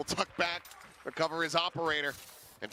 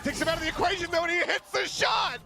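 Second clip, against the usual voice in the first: screaming hype call, high and loud; esports caster; 7 dB louder than usual. Real, not acted. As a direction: erupt on the clutch shot. Clutch shot called at peak.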